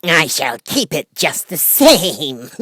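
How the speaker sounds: high, slow, laughing through the words; a witch cackling at a child; mocking witch laugh. A narrator's reading. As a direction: taunt her with a laugh in the voice. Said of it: "laughing at her".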